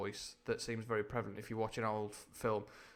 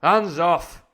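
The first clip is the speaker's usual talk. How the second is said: loud, high sudden shout; explosive acted command; cried warning; 7 semitones higher, 17 dB louder than usual. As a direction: yell it while recoiling. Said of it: "cried Silver, leaping back".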